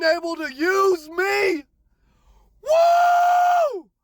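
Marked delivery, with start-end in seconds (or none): 2.6-3.9 high, loud whoop of joy; joyful whoop. Real, not acted. Celebrating a year sober on the ride home, big high whoop. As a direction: let out a big celebratory woo.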